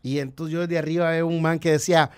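usual speaking voice

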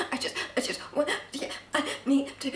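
soft, unvoiced, barely-there words; shaky whisper to the manager; breathless whisper. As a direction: whisper through the panic. Panic attack, manager offers room.

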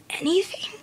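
high, breathy, hoarse plea; hoarse whisper. A narrator's reading. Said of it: "added in a hoarse whisper".

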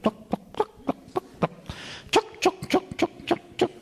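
whispered then squeaky tuck tuck clucks; hen clucking. Retold or imitated. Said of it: "they're sort of".

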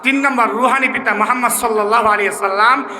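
ordinary talk

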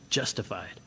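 low, soft, falling justified; hushed justified. Real, not acted. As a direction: breathe justified out quietly. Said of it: "I love this word".

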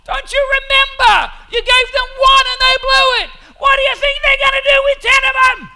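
high, loud pleading shout; angels' panicked protest. Acted. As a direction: yell it up at God in alarm. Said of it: "And the angels are going".

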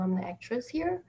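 ordinary delivery

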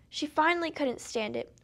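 plain reading voice

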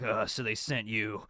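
low mocking prisoner voice; [name] sneering. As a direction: talk down to the boy from a cell. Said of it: "he's just like".

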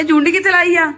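high, loud teasing question; dramatic loud aunty. Real, not acted.

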